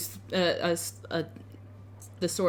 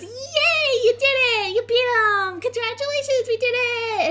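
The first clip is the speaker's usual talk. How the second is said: very high, squealing cheer; over-the-top high-pitched glee; jubilant tiefling girl; 6 dB louder than usual. Acted. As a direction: celebrate loudly in a girlish squeal. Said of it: "sticks her arms up".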